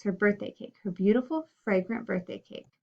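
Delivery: ordinary delivery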